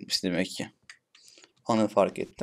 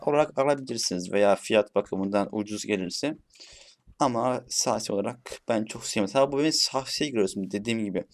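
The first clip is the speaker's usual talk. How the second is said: normal talking voice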